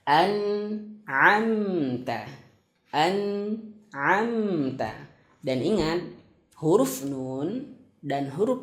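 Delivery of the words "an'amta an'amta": An'amta slowly with a clear n and m; teacher modelling clear izhar. Shown as a demonstration. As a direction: pronounce An'amta with each sound distinct. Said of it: "harus jelas".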